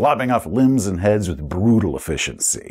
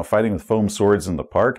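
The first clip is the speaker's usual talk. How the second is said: ordinary talk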